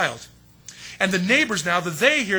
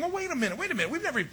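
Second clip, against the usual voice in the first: mock surprise; 10 semitones higher, 4 dB quieter, faster than usual. Neighbors balk at new name.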